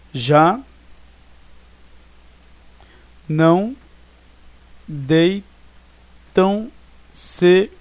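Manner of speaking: "já não deitam sequer uma flor" with long gaps between syllables. Shown as a demonstration. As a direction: dictate the verse slowly, one syllable at a time. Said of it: "Eu separei como se separa".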